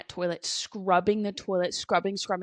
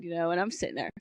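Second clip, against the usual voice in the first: ordinary talk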